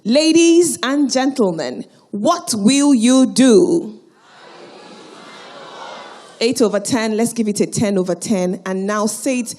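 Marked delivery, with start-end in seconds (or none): 0.0-4.0 slow, drawn-out shout to the hall; rallying shout. Real, not acted. Host calling out to the audience, drawn-out rallying shout. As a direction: whip the crowd up, stretching every word.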